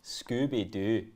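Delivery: drawn-out Scottish accent; playful, slowed-down Scottish voice. Shown as a demonstration. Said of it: "You're actually good at accents".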